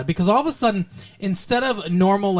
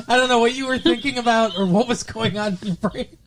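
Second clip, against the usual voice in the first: normal talking voice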